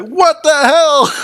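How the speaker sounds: very high, slow squeaky cat voice; voiced confused cat. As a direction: give the cat a squeaky bewildered voice. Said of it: "this poor cat is like".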